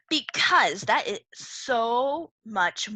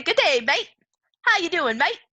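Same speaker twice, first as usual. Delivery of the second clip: a light hint of Australian, "Good day, mate" lifted and loud; cheery Australian greeting, lightly done; Australian accent; 7 semitones higher, 6 dB louder than usual. Teacher showing an Australian greeting: light Australian accent. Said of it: "Australia, you say".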